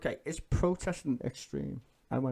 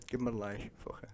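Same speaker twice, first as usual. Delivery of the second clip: hushed whispered aside; whispering; 10 dB quieter, much faster than usual. Real, not acted. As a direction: whisper it under your breath. Aside while promoting a channel.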